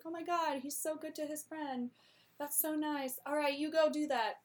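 gushing. Retold or imitated. Portia gushing.

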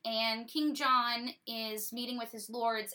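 ordinary talk

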